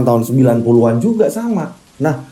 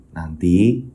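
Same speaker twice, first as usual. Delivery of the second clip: suara Bayu lirih; 10 semitones lower, 4 dB quieter than usual. Acted. Bayu's voice in the story. (suara anak lirih dan pelan, seperti berpamitan)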